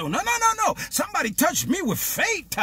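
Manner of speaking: high-pitched rapid protest; insistent denial. Acted. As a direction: brush the objection aside in a high voice. Jesus insists against disciples.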